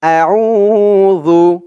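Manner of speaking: আউযু stretched long and chanted; drawn-out recitation. Shown as a demonstration. Teacher models আউযু for students, drawn-out chant. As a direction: sing out আউযু slowly for students to repeat. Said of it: "তো আমার সাথে বলুন".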